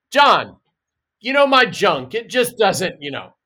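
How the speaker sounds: high, loud, embarrassed blurt; awkward confession. Pretend. Man confessing his junk trouble to his mate John, embarrassed blurt. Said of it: "I'm gonna go up to you and go".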